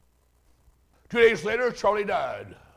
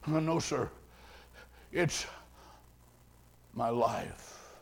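retold faint whisper; 8 semitones lower, 12 dB quieter, slower than usual. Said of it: "Just a whisper".

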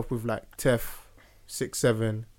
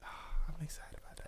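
whispering; 2 semitones higher, 15 dB quieter than usual. Acted. Mock hushed excitement.

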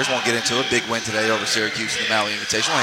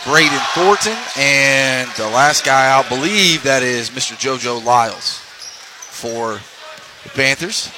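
slow, drawn-out lineup announcement; announcer voice; 2 semitones higher, much slower than usual. Acted. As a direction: call each name out slowly.

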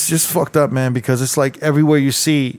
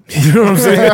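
laughing through the question, higher and louder; real laughter while asking; chuckling as he asks if you get it; 5 semitones higher, 6 dB louder than usual. Real, not acted. Laughing about a wild life.

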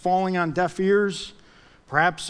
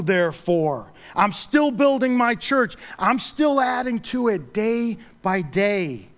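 loud, raised-pitch sermon climax; forceful preaching; 4 semitones higher, 3 dB louder than usual. Real, not acted. Preacher confronting the church over God's will, emphatic preaching. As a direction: drive each phrase home to the congregation.

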